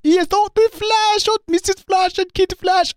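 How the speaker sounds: very high, put-on eccentric character voice; Herr Wells. Host imitates the returning Wells, high put-on character voice. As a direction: mimic an eccentric character far above your own pitch. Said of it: "we got a return of Hair Wells".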